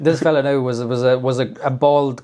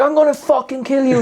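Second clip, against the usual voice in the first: high, heated retold road rage; mock furious threat; 10 semitones higher than usual. Retelling the driver threatening him, mock fury. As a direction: threaten in a raised, worked-up pitch.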